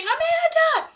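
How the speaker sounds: high, loud mock scream of a name; hammed-up anguished yell. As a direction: cry out for a girlfriend, acted.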